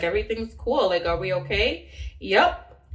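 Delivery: usual speaking voice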